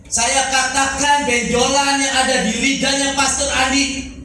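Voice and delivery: high, loud proclamation, stretched out; preacher's shouted declaration. Retold or imitated. Preacher re-enacting his vow before the congregation, shouted declaration. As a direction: cry it out to the congregation. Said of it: "Saya teriak di depan ibadah gini".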